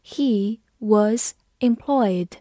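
slow, separated words with pauses; slow read-along. Shown as a demonstration. Teacher modelling the hotel cook sentence for learners, slow read-along. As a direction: read it word by word, unhurried. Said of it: "好，我们慢读一遍".

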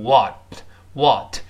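American "what" with a wide, open vowel; American pronunciation. Shown as a demonstration. Teacher shows the American version, slow open "what". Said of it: "在美音中变大".

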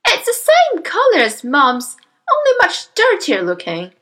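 put-on high-pitched boy remarking to Dad; son in the story. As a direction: pipe it up loudly. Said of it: "the son said".

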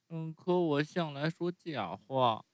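高一些的童声，慢吞吞地说; 像小孩承认坏事那样拖着说; 木偶慢慢认错. Acted. Narrator voices Pinocchio. (用稍高的童声慢慢坦白)

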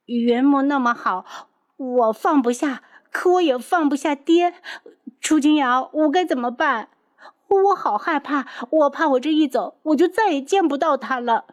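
put-on high, tearful young woman; tearful pleading. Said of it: "眼泪忽然吧嗒吧嗒的往下掉".

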